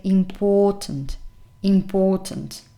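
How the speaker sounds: important, leaning on POR; im-POR-tant, clearly stressed in the middle; stress on second syllable. Shown as a demonstration. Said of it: "you have the stress here".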